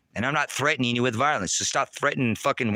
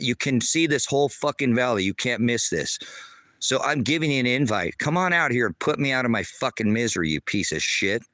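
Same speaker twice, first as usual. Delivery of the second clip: ordinary talk, nothing marked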